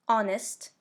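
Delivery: "honest" said as ON-ist, H dropped; silent H. Shown as a demonstration. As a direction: start "honest" straight on the vowel, no H sound. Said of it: "on ne le prononce pas".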